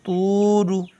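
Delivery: तू रू with तू held long and रू quick; slow recitation drill; stretched तू, short रू. Shown as a demonstration. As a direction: draw out तू, clip रू. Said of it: "रा को जल्दी".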